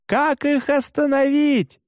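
high, drawn-out yell across distance; shouted question. A narrator's reading. Novice driver asks how to stop reindeer, shouting. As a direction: call out urgently for instructions. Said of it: "закричал я, что есть мочи".

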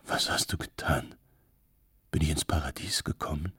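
tiefe, leise, gehauchte Männerfigur; fast geflüstertes, tiefes Staunen; staunender Mann. A narrator's reading. Verblüffter Mann staunt über Lichtermeer. (tief und ehrfürchtig gehaucht, leise staunend)

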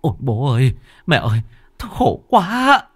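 high, loud desperate begging; pleading son. Acted. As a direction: beg dad to calm down. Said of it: "van xin". (van xin khẩn thiết)

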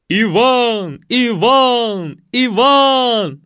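high, loud crowd chant, each call drawn out; скандирование. Acted. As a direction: chant the name like a cheering crowd. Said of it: "стали скандировать остальные". (громко скандировать)

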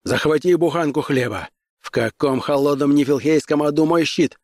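impatient hero in a rush; urgent orders. A narrator's reading. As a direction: fire off orders while fleeing. Conan hurriedly orders Diana while fleeing.

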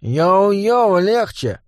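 dance and chuckle it; laughing goblin. Acted. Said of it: "приплясывая, рассмеялся". (гоблин со смешком)

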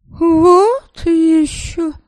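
high, slow, outraged scoff; indignant. Acted. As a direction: huff it out in disgust. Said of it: "возмутилась его спутница".

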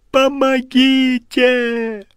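high, slow scream of terror; terrified scream. Acted. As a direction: drag out the cry for help. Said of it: "Он проснулся в холодном поту".

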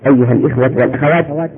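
ordinary delivery

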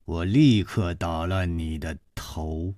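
low, cold and hard threat; a cold woman threatening, low and hard. Acted. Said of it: "这个声音又冷又硬".

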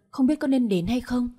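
usual speaking voice

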